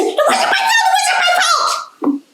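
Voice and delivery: shrill, very high squealing protest; panicky cat. Acted. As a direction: squeal it running away. Cat flees onto shore.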